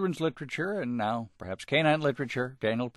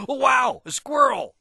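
excited shout; 8 dB louder than usual. Acted. Dog spots squirrel, shouts.

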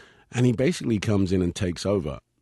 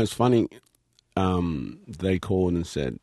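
ordinary delivery

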